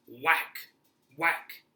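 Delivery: punchy, high, loud whacks; loud impact words. A narrator's reading. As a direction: shout each blow like a sound effect. Acting out shovel blows.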